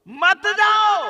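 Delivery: high, loud drawn-out plea; acted imploring wail at full volume; pleading preacher's cry. Wife's plea, now cried out.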